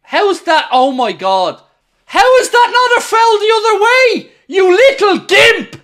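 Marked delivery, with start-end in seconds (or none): 2.0-5.8 angry shout. Real, not acted. Fan fuming at a missed foul.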